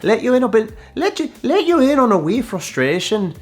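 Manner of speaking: high, hammed-up Irish lilt; Irish accent. Host toying with his slip, in a mock Irish accent. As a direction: put on a playful, high-pitched Irish brogue. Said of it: "with an Irish accent".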